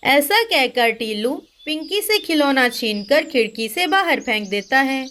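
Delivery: usual speaking voice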